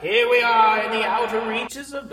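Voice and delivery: echoing spacey voice effect; space echo effect. Shown as a demonstration. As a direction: sound like you're out in space. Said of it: "this one's more like a, I'm out in space".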